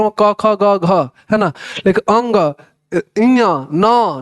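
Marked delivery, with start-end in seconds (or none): 3.2-4.2 slow nasal demo of इया and ना, drawn out through the nose; nasal sounds demo. Shown as a demonstration. Teacher showing students nasal letters, said slowly through the nose. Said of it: "ये सब कुछ नाक से बोला जा रहा है".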